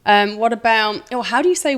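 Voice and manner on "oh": ordinary talk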